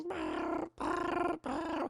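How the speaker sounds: very high, soft, squeaky little-creature voice; cute critter noise. Retold or imitated. The Tribble-like furball greets Jen, very high soft cute squeaks.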